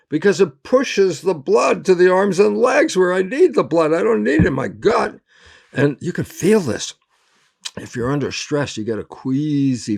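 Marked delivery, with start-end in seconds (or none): 0.1-5.2 high, loud, urgent explanation; emphatic answer. Acted. Lecturer answering his own "why?", emphatic and high. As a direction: drive it home in a raised, excited voice.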